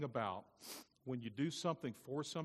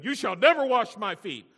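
high, very loud refusal; shouted rebuke; 10 semitones higher, 19 dB louder than usual. Acted. Peter refusing to let Jesus wash him, shouted rebuke. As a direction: thunder it out as a defiant refusal.

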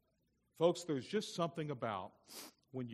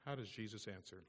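normal talking voice

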